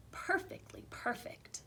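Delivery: hushed whispered ending of the refrain. A narrator's reading. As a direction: whisper it like a secret. Whispered refrain in read-aloud story.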